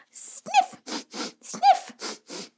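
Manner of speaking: high squeaky sniffs; sniffing. Acted. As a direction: sniff like a cold. Acting out sniffles.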